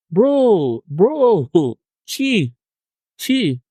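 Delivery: high, drawn-out groaning appeal; mock exasperation. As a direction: react in exaggerated dismay, high-pitched. Acts exasperated reaction to spooky messages.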